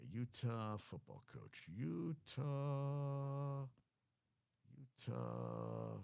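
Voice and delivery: slow, soft, drawn-out syllables; absent-minded muttering. Real, not acted. Host typing a coach search, muttering slowly to himself. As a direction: talk to yourself as you type.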